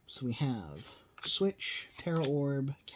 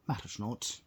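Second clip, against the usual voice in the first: put-on Scottish or Irish accent; 3 semitones lower, 5 dB quieter than usual. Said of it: "slip into an accent like Scottish or Irish".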